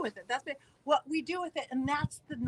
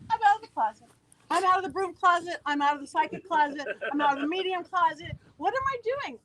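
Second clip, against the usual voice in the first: high, loud dawning realization; mock panic; 5 semitones higher, 4 dB louder than usual. Realizing she's out of the psychic closet, mock-panicked. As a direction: blurt each confession in comic alarm.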